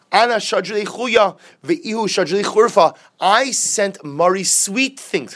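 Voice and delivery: mock indignant complaint. Rabbah's complaint, voiced.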